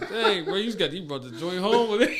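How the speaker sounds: loud, high, cracking up mid-joke; laughing teasing. Retold or imitated. Retelling his joke about a prison phone, laughing teasing. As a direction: blurt the tease through laughter.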